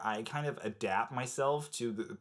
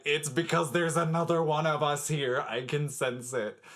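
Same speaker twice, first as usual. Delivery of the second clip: dramatic camp reply; 4 semitones higher, 5 dB louder than usual. Retold or imitated. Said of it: "And I'm like".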